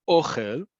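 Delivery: "ochel" stressed on the O, OH-khel; first-syllable stress. Shown as a demonstration. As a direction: hit the first syllable of "ochel", ending on a rise. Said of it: "emphasis on the first syllable".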